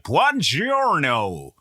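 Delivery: long, drawn-out Midwestern buongiorno; mock Italian greeting in a Midwest accent. Host imitates Drew Carey saying Italian, in Midwest accent. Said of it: "in the most Midwest accent".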